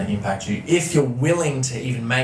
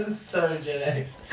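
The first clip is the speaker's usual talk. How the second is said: laughing; 9 semitones higher, much slower than usual. Real, not acted. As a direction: savour the joke as you laugh. Laughing at dad's advice.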